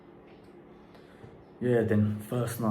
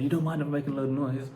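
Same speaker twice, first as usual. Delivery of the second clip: higher, faster repeat so he can hear; raised, quick repeat to a hard-of-hearing man; 6 semitones higher, faster than usual. Retold or imitated. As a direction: say it again, pitched up and hurried, for the deaf neighbour. Repeats question after neighbour says huh.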